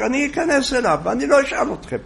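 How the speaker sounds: high insistent old woman, mock yell. A narrator's reading. Old woman insists on entering the rabbi's room, high mock yell. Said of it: "ושמע אותה ממשיכה לצעוק".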